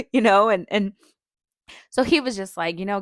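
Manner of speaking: normal talking voice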